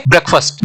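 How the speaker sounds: "ब्रेकफास्ट" as brek-fust, short second vowel; correct contracted pronunciation. Shown as a demonstration. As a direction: run k and f together in "ब्रेकफास्ट". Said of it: "इसको हम बोलेंगे". ("ब्रेकफास्ट" को मिलाकर ब्रेक्फ़स्ट बोलें)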